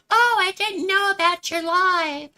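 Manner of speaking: very high, loud mocking whine; mock complaining viewer. Streamer imitating people who missed the live, high mocking voice. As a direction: imitate a clueless viewer in a squeaky put-on voice.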